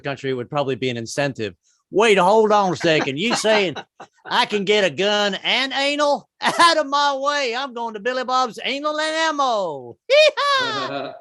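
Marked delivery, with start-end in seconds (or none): 1.9-10.0 high, drawn-out yokel voice; hammed-up good-old-boy protest, slow and high; indignant gun lover. Acts out gun-loving rural customer. 10.6-11.1 high-pitched cowboy whoop; hammed-up country yeehaw. Whoop ending gun buyer bit.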